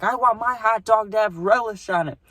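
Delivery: mimicking a cranky old customer; old lady. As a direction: squeak it like an irate old woman. Angry old lady voiced in story.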